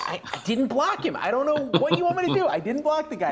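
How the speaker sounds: high, stammered imitation of pleading innocence; exasperated protest. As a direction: protest in mock exasperation, voice climbing. Retold protest to accusing mother.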